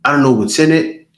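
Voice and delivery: normal talking voice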